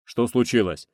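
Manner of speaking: anxious voice coming over the radio; alarmed. A narrator's reading. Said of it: "встревоженный голос Леонова". (встревоженно)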